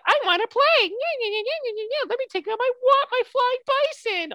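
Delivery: put-on very high, bouncy childlike squeal; excited kid. Boy just out of the ice wants to play, hyper excited kid voice. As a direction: play an overeager kid begging to play. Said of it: "very excited to play".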